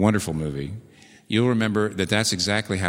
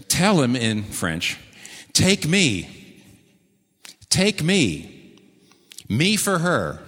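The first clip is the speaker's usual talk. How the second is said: retold urgent plea, higher on each cry; upset pleading; 5 semitones higher, much slower than usual. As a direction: plead in short, separated bursts, pitched up. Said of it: "Hawkeye is really upset".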